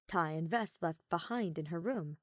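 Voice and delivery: ordinary delivery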